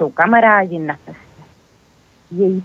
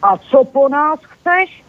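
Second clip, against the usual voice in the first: a slow, higher mock roar; mild roar; 6 semitones higher, slower than usual. A narrator's reading. Bear shouting at the robber, hammed-up mild roar. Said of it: "zařval na něj medvěd Břeťa".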